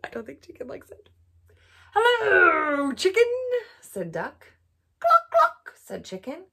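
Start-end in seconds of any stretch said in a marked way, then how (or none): 1.9-3.3 cheerful duck greeting. Acted. Duck greets chicken in story. 5.0-5.7 high loud chicken cluck. Acted. Chicken voiced in story.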